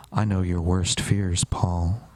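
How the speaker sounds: ominous friend. Retold or imitated. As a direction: play the knowing creep, low and slow. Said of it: "Ben goes".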